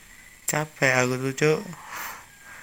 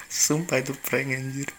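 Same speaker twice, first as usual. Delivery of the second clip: ordinary talk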